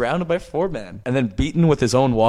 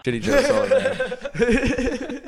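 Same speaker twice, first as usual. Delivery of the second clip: laughing; 11 semitones higher, much slower than usual. Real, not acted. Laughing at the pun.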